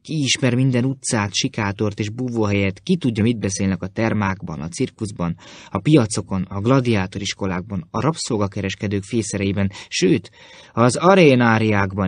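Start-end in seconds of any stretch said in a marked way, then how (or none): none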